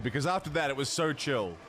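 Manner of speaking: usual speaking voice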